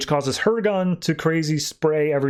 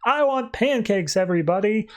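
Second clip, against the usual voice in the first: mock calling out; 7 semitones higher than usual. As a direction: pretend to call out of a window. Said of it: "walks out to the window and says".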